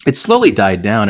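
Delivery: ordinary talk, nothing marked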